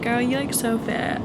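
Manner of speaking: lightly put-on American accent. Imitating a stranger at the station, American accent. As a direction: quote her in a mild American accent. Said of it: "I did an American accent".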